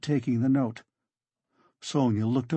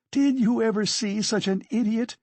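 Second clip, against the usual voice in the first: high-pitched incredulous scorn; hysterical scorn; 9 semitones higher than usual. Acted. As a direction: appeal to everyone, high and scornful. Hysterical Katerina mocks her accuser.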